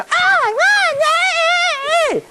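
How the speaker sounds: very high, drawn-out squeal of excitement; mock winner's cheer. Speaker shows students how they'd celebrate winning, mock excited squeal.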